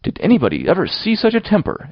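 high, scandalised outcry; swooping, appalled matron; horrified exclamation. A narrator's reading. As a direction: exclaim aghast at the behaviour. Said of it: "exclaimed the horrified Missus Rachel".

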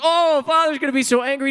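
high, loud mock wail; panicked boy. Boy seeing the pile of hay, mock wailing. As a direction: cry out as a child dreading trouble.

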